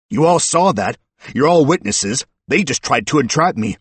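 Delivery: high, loud indignant call to witnesses; rally the whole room in protest; loud appeal. A narrator's reading. Suspect appealing to the other patrons, loud appeal.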